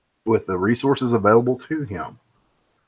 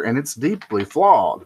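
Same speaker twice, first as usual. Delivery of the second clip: ordinary talk, nothing marked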